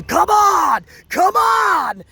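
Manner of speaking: mock wrestler's scream, high and loud; imitated screaming. Host imitating Gunther goading Sheamus across the ring, screaming. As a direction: shout it high, a drawn-out challenge. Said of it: "Gunther screaming".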